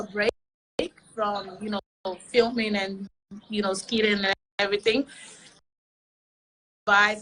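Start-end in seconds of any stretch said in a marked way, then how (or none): none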